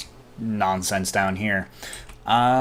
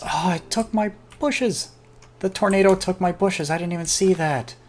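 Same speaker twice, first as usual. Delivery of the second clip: surprised; 9 semitones higher than usual. Real, not acted. Tornado takes his bushes.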